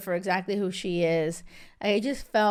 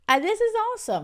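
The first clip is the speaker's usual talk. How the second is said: much higher, bright excitement; delighted; 13 semitones higher, 3 dB louder, much faster than usual. Real, not acted. Vlogger gushes to viewers about the couple, excited. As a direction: gush with delight.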